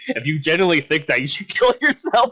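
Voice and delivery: laughing mock insult; mocking quote. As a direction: quote him, cracking up. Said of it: "he much was like".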